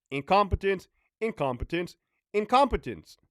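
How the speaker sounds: each "incompetence" hit hard and high; emphatic repetition. Real, not acted. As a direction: hammer the word "incompetence" three times. Ranting about incompetent prison staff.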